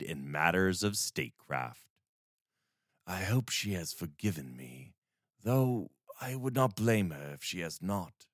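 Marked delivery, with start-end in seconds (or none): 3.1-8.3 quiet inner thought. Acted. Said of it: "thought to himself".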